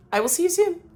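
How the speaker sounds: high sing-song goodbye; sing-song bye to viewers; bright sign-off. Real, not acted. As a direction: chirp the farewell up high. Cheerful sign-off.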